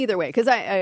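usual speaking voice

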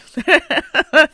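high, loud laugh of delight. Real, not acted. Host laughing after hearing the poem. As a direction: laugh out in real joy. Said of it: "I love it".

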